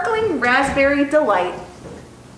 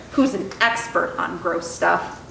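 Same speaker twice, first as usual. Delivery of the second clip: ordinary delivery, nothing marked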